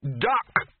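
sudden loud, high cry; shouted warning. A narrator's reading. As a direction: shout it to make everyone drop. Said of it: "shouted the Arch-Chancellor".

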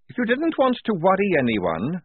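high, irritated soldier's voice; Tonka. A narrator's reading. Soldier rebuking a worried comrade, high irritated voice. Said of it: "came the voice of Tonka".

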